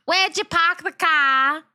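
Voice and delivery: Boston "Where'd you pahk the cah?"; mock Boston accent. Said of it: "I hate the Boston accent".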